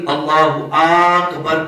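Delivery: اکبر begun with a long 'Aak-bar'; wrong long alif in Akbar. Shown as a demonstration. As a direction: stretch the first A of اکبر. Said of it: "اکبر کے الف کو بھی لمبا نہیں کرنا". (اکبر کا الف کھینچ کر)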